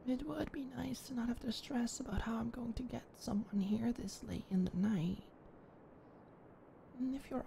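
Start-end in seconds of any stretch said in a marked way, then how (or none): 0.1-5.2 thoughtful whisper. Acted. Weighing the offer to stay, soft whisper. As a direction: think it through under your breath. Thinking aloud, relieved.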